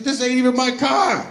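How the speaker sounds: high, loud, brazen; cocky driver. Acted. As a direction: toss it off like it's nothing. Driver mocking officer.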